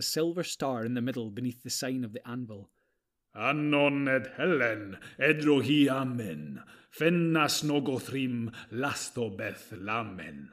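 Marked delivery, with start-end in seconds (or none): 3.3-10.5 slow, grave incantation in an otherworldly voice; slow commanding incantation; commanding spell. A narrator's reading. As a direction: intone the elvish spell with command. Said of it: "he said in a commanding voice".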